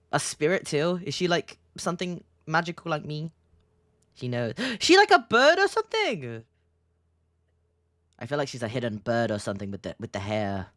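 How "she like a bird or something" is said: high, loud surprised gasp; gasping realization. Real, not acted. Streamer suddenly suspecting the barista, gasping. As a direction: blurt it out in discovery.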